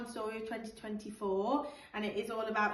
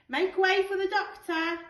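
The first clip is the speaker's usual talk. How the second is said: higher, louder call to the crowd; urgent playful shout; 9 semitones higher, 8 dB louder than usual. Acted. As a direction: clear the path for the doctor. Luther clearing the crowds.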